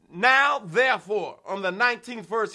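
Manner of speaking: ordinary talk, nothing marked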